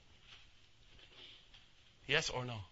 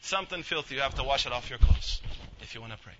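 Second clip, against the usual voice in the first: ordinary talk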